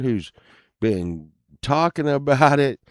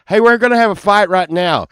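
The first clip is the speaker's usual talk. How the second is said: mock challenge; 7 semitones higher, 7 dB louder than usual. Mock formal fight challenge.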